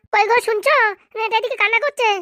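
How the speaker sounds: high-pitched summons to a spouse; alarmed calling out. Acted. Wife calls husband to crying daughter, alarmed. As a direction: call out in alarm for help.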